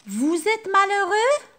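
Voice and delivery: very high, loud rising "Vous êtes malheureux?"; teacher demos question intonation. Shown as a demonstration. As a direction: ask it with a big questioning lift. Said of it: "J'ai changé l'intonation".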